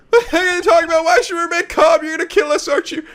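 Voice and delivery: panicked passenger voice. Acted.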